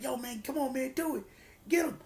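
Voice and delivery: high, pressing urge to strike; urging. Retold or imitated. Teammates urging Jay to finish the fallen man. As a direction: push him to do it, pitch climbing.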